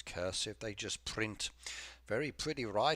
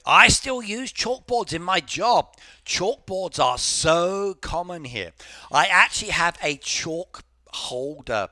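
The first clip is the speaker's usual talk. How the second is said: loud; 8 semitones higher, 14 dB louder than usual. Real, not acted. Sudden loud burst on chalkboards.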